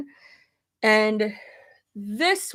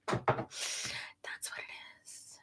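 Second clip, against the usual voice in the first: slow, very soft breath-only whisper; drawn-out whisper; 16 dB quieter, much slower than usual. Real, not acted. Streamer confiding her skincare secret to viewers, drawn-out whisper. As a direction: let it out slowly in a whisper.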